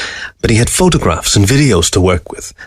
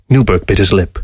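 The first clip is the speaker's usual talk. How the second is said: plain reading voice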